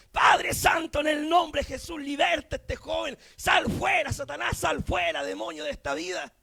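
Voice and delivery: loud, fervent deliverance prayer; imitating forceful Pentecostal praying. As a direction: cry out as if casting out a demon. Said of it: "Como oraciones pentecostales".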